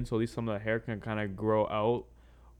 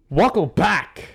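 loud, high hype welcome; podcast host intro; 8 semitones higher, 10 dB louder, slower than usual. Acted. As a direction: greet the listeners like a big show host.

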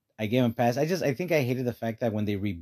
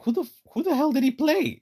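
much higher, incredulous outburst; baffled; 4 dB louder than usual. Retold or imitated. Retelling a baffled actor asking who he played, high and incredulous. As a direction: play the confused actor in disbelief. Pedro Pascal confused, retold.

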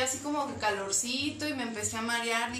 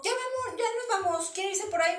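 imitating the brother, higher; 8 semitones higher than usual. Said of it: "tu hermano dijo".

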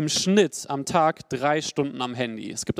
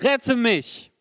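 high cry for help, raised pitch; cried out; 9 semitones higher, 4 dB louder than usual. A narrator's reading. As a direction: call out to the Lord in alarm. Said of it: "schrie er".

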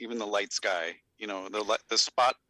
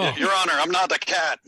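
radio voice; 4 semitones higher, 6 dB louder than usual. Real, not acted. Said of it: "you sound like a nineteen forties radio".

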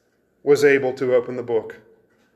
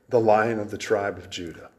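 usual speaking voice

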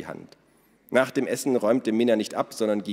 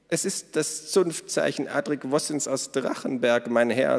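mock formal; 6 semitones higher than usual. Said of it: "mit einer übertriebenen Verbeugung".

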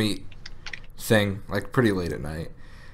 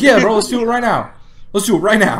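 much higher, louder eager voice; mock eager girlfriend; 11 semitones higher, 8 dB louder than usual. Imagining the girlfriend jumping at the offer, high loud mock eagerness. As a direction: gush it like a keen girlfriend. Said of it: "She'd be like".

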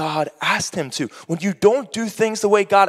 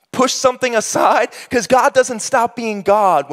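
high, raised preacher's voice; impassioned preaching; 6 semitones higher, 4 dB louder than usual. Real, not acted. Preacher telling the congregation about Obed-Edom's blessing, high and forceful. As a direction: preach it with rising force and punch.

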